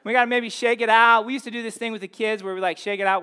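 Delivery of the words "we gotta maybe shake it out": louder, pitched up to jolt the room awake; rousing wake-up call. Real, not acted. Preacher rousing a sleepy congregation, loud and lifted. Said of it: "we're gonna wake up".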